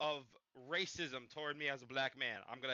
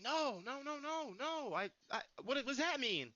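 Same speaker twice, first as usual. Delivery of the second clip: high-pitched string of disbelieving nos; retold alarm; 10 semitones higher, slower than usual. Storyteller as fifth grader learning about racism, alarmed. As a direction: act out a startled kid, pitched way up.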